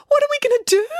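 high-pitched wail of alarm. Acted. Girl on a sinking chocolate boat, wailing. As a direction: wail it in a rising panic. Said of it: "wailed Samantha".

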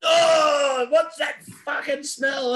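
high, loud, disgusted yell as Dad; hammed-up high-pitched father yelling in disgust; mock shouting dad. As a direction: shriek it like a dad finding a foul stink, slowing on the last words. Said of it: "you just hear Dad going".

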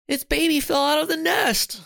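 put-on boy shouting that the baby bird fell, high and loud; exclaimed. A narrator's reading. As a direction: cry out the alarm to the others. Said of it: "he exclaimed".

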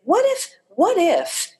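high, drawn-out questioning; voice of the soul. Retold or imitated. Speaker voices their soul against the rational mind, high and slow. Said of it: "And my soul, spirit, higher, whatever was saying".

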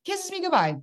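can-you-believe-it high voice; incredulous repeat. Real, not acted. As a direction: pitch it way up in disbelief. Repeats it in disbelief.

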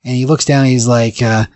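ordinary delivery

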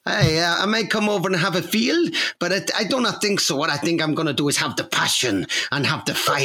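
hammed-up, muddled Greek accent; bad Greek accent. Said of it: "the worst Greek accent I've ever heard".

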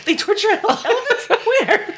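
very high, laughing, naive shock. Retold or imitated. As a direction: squeak it out in mock shock. Mocking friend's shocked cluelessness.

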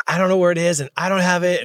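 a mild mock yell of lost ID; retold frantic yelling. Client who can't find his wallet, a mild mock panicked yell. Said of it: "like, screaming".

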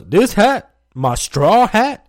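high, loud incredulous questions; mock indignant coach. Coach challenging a player about his hat, mock indignant.

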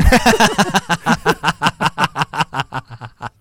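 loud, long real laugh; loud burst of laughter. Real, not acted. Host laughing at his own alter joke, loud real laughter. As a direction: crack up at your own joke.

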